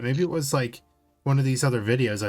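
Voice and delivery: ordinary talk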